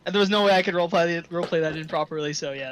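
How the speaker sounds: normal talking voice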